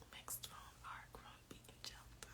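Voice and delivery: barely audible whispered read-through; whispering to oneself. Real, not acted. Host rereading the quiz list to herself, whispered. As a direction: whisper the words under your breath.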